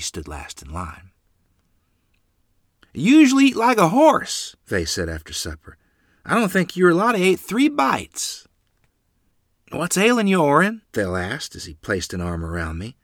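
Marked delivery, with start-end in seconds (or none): 2.9-4.6 high, loud lively character voice; uncle's voice. A narrator's reading. Uncle Vay comments after supper, high voice. 6.3-8.5 high-pitched character remark; uncle's voice. A narrator's reading. Uncle Vay notices untouched supper, high voice. 9.7-10.8 higher, questioning character voice; concerned uncle. A narrator's reading. Uncle Phil checks on the boy, higher concerned voice.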